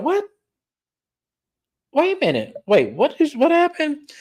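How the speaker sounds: high, startled what-happened; shocked confusion. Retold or imitated. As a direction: pitch it way up in mock disbelief. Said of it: "Angel looked at Kay, was like".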